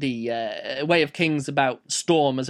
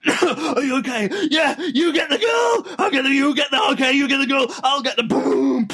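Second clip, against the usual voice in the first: high, urgent mock battle shouts; hammed-up hero calling through explosions; play-acted action hero; 8 semitones higher, 3 dB louder than usual. Hero to partner during a pretend rescue, urgent shouting.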